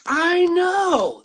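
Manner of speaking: high, loud, gleeful exclamation; excited agreement. Real, not acted. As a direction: gush it, drawn out and delighted. Delighted greeting after time apart.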